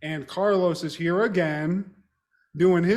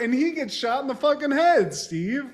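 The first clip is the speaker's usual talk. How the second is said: normal talking voice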